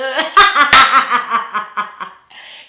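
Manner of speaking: loud high laugh bursting out; loud laughter. Real, not acted. Laughing loud at her own "da da da" mimicry. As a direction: crack up laughing.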